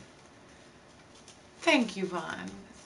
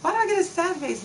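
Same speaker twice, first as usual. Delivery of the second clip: high, fast mock-offended question; indignant surprise; 9 semitones higher, 5 dB louder, faster than usual. Real, not acted. Repeats the question higher and faster.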